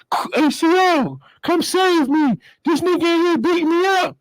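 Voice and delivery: high, pleading, put-on voice; whiny inmate plea. Inmate crying to the guard for rescue, high and pleading. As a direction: beg the guard like a helpless inmate.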